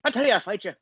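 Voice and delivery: high Irish traveller brogue; Mickey from Snatch. Retold or imitated. Impersonating the Snatch boxer for his co-host, high Irish brogue. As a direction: mumble it like Brad Pitt's Mickey in Snatch. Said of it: "Brad Pitt's character in Snatch".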